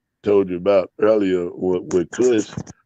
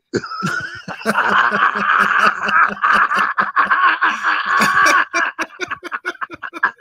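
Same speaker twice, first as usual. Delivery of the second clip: long laughing fit. Real, not acted. Cracks up at his own joke.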